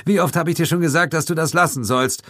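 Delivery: put-on fast, high annoyed young cop; snapping. A narrator's reading. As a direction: snap at him, fast and irritated. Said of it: "fauchte der junge Polizist".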